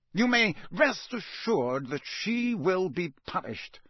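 slow, icy official promise; measured and chilling; cold. A narrator's reading. Mr Crouch coldly promises punishment, slow and icy. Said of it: "Mister Crouch added coldly".

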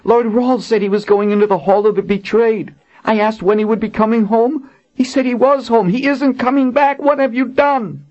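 high, remorseful queen; sorry and pleading, pitched high; Colin. Acted. Colin voiced in the story.

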